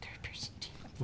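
unvoiced whispered echo. Real, not acted. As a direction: breathe the words under your breath. Echoing the host.

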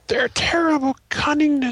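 high, loud dramatic read; hammed-up high-pitched proclamation; theatrical reading. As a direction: declaim it way up high. Reads goblin game text dramatically.

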